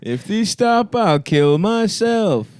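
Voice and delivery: mocking loser voice. Friend mocking the gamer, slow high whine. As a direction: wail it melodramatically.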